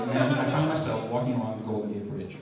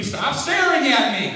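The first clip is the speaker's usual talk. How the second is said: mock scream; 12 semitones higher, 8 dB louder, slower than usual. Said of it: "bite off the eyes and scream".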